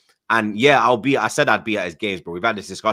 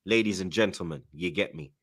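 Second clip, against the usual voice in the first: ordinary talk, nothing marked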